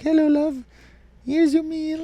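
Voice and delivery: high, sweet doting-wife voice. Pretend. Wife greeting husband home with dinner, high sweet voice. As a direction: play the cooing housewife greeting him. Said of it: "and she's like".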